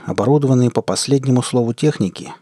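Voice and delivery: plain reading voice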